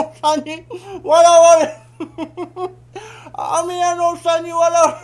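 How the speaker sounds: laughing so hard the words break up; helpless laughter. Acted. Father laughing helplessly at the candy man's joke. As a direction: crack up mid-sentence, high and loud.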